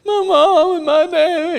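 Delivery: hammed-up sobbing plea to the patrolman; mock crying. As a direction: whine it like a big man faking tears. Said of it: "I just cried and told him".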